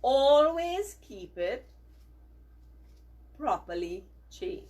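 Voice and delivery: slow, loud, every word spaced; measured teacher dictation. Shown as a demonstration. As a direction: dictate so the children can repeat. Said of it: "repeat these words after me".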